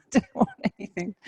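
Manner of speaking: high-pitched, breaking into laughter mid-sentence; laughing. Real, not acted. Yoga teacher quoting people reluctant to change, laughing. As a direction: let real laughter take over the words.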